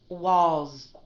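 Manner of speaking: Walls ending in a long, stretched z. Shown as a demonstration. Teacher modeling Walls for her student, long stretched z. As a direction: hold the z at the end of Walls. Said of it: "listen to the way that I pronounce this word".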